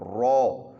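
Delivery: رو in a full, heavy voice with rounded lips; full-mouthed رو. Shown as a demonstration. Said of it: "موٹی آواز کے اندر منہ گول کر کے".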